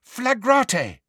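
high-pitched incantation called out; sudden, high spell-casting call; spell cry. A narrator's reading. Character calls out a spell.

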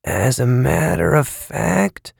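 tight, slow and strained. A narrator's reading. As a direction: force the words out tight. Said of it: "in a strained voice".

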